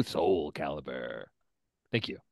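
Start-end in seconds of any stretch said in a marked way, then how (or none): 0.0-1.2 deep mock announcer; game-announcer voice. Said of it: "say it in that voice".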